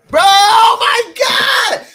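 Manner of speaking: a huge drawn-out yell of hype; slow, loud, high shout of disbelief; excited shout. Real, not acted. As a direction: scream it out in sheer excitement. Hyped reaction to the trailer.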